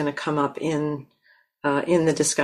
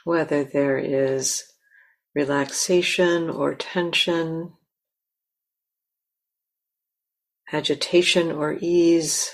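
guided meditation. Acted. Teacher guiding the listeners to land in themselves, calm and unhurried. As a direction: gently lead them into stillness.

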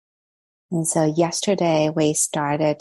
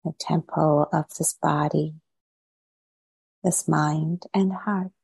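guided meditation. Real, not acted. Teacher settling online meditators into posture and body, guided meditation. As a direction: ease them gently into stillness, leaving long pauses between phrases.

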